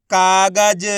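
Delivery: कागज loud and stretched out; drawn-out model of कागज; blended word. Shown as a demonstration. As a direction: read कागज holding each syllable. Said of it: "किस तरीके से इनका उच्चारण करके".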